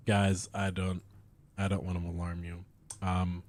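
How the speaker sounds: low, quiet, fast deadpan; deadpan hushed understatement. Real, not acted. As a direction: murmur it like grave news. Mock grave understatement.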